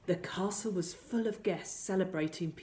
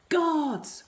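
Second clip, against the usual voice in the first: high, loud royal command; ordering king; 10 semitones higher, 8 dB louder than usual. A narrator's reading. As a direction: call out for the guards like a king. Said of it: "the king ordered".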